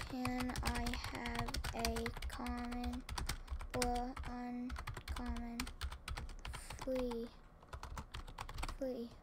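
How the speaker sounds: slow drawn-out begging; pleading. Real, not acted. Player begging others for a common, slow pleading. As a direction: stretch it out like begging a friend.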